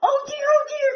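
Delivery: high, loud frantic wail; crying pig. A narrator's reading. Pig crying to the hippo in fright, high wail. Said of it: "cried the pig".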